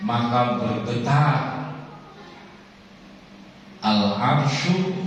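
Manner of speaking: high, slow, drawn-out chanting; slow sermon recitation. Acted. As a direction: make the throne tremble in their ears. Hadith recited then explained slowly. (melantunkan hadis dengan pelan)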